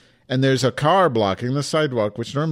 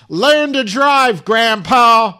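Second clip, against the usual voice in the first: shrieking high rage, slow and stretched; screaming; 12 semitones higher, 9 dB louder, much slower than usual. Retold or imitated. Yelling at the old man in the SUV: furious scream. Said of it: "I just kept screaming".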